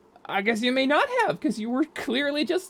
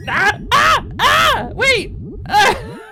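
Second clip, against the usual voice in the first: startled screams; 12 semitones higher, 8 dB louder, much slower than usual. Real, not acted. Door left open, slimes rush in.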